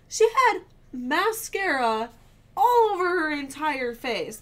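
loud, high, slow exasperation; re-enacted indignant complaint to a partner; pissed off. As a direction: stretch the words in outraged disbelief. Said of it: "I was so pissed".

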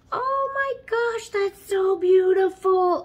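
high gasping oh my gosh; delighted gasp. Real, not acted.

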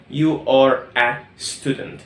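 You are a student stretched very slowly; teacher modelling each word; slow, clear classroom drill; slow model sentence. Shown as a demonstration. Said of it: "بقول عنك أنت".

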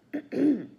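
acted, loud clearing of the throat. A narrator's reading. Nervous boy before addressing villain, throat clearing. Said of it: "Mucius cleared his throat".

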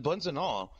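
ordinary talk

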